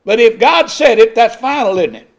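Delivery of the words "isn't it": usual speaking voice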